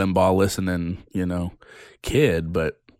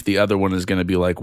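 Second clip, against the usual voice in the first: ordinary talk, nothing marked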